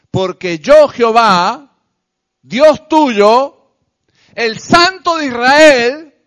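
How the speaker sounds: loud, slow, rising proclamation; full-force preaching. Real, not acted.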